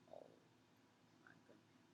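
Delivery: very soft, under-the-breath "oh"; retold hushed dismay. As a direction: barely voice it, sinking in dismay. Reenacts dismay at the gum.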